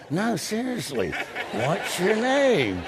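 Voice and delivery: high, slow, drawn-out insistent plea; mock-earnest repeated question, voiced high. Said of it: "he thought I was kidding".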